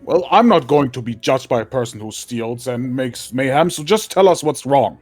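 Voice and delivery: ordinary talk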